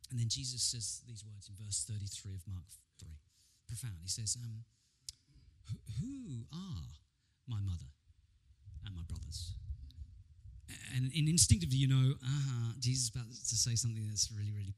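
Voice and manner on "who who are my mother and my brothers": weighty question left hanging; measured, pondering question. Acted. Acts Jesus's profound question.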